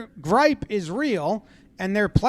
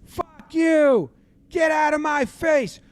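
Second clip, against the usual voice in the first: high-pitched scuffle shouts; mock angry yelling; 9 semitones higher, slower than usual. Coach being held back in a scuffle, high angry yelling. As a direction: imitate a coach fighting people off.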